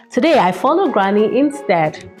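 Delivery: ordinary delivery, nothing marked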